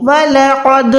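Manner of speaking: वलक़द with the final d bouncing back; qalqalah. Shown as a demonstration. Teacher demonstrating to students, qalqalah bounce on वलक़द. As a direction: let the द of वलक़द echo with a small release. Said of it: "आवाज़ लौटता हुआ महसूस हो".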